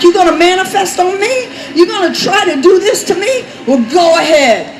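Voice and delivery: loud, high taunting questions, then a dare; defiant challenge. Retold or imitated. Preacher retelling the standoff with the woman, defiant.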